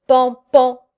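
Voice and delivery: deux coups sonores à la porte, dits avec la voix; coups imités. Retold or imitated. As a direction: frapper fort avec les mots. (coups frappés, bien sonores)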